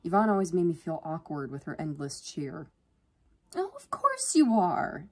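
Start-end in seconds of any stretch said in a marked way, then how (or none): 3.6-5.1 high, drawn-out gush. A narrator's reading. Yvonne reassuring her sister's old friend, gushing. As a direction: gush it slowly with high-pitched cheer. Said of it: "Yvonne gushed".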